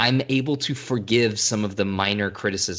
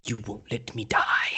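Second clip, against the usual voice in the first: put-on whispered British accent. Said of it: "he slips back into his English accent".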